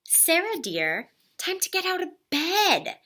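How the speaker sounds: high sing-song wake-up call; gentle father voice. Acted. Father waking his daughter, high sing-song call. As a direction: coax her out of bed lightly.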